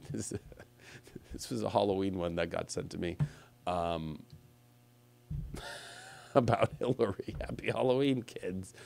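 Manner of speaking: low, hushed, chuckling to oneself; amused aside. Real, not acted. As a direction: drop right down to a soft, private aside. Amused aside while browsing pictures.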